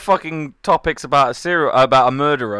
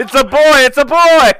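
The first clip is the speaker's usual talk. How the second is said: excited shout; 11 semitones higher, 14 dB louder than usual. Pretend. Gender reveal announcement shout.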